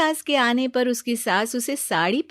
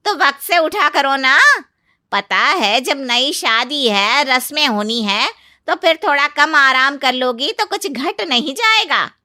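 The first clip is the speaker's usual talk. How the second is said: loud, raised-pitch lecture; scolding aunt-in-law; 5 semitones higher, 8 dB louder than usual. Acted. Aunt-in-law lectures the new bride about resting too much, scolding. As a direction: chide the newcomer forcefully.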